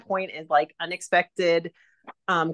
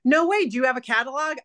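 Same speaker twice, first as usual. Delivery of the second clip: excited; 7 semitones higher, faster than usual. Retold or imitated. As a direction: gush like you can't believe your luck. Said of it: "And she was like".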